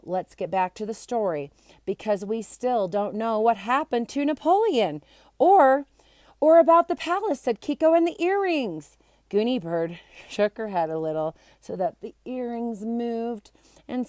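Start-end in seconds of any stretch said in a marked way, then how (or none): 5.4-9.0 excited. A narrator's reading. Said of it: "Kiko's excited voice".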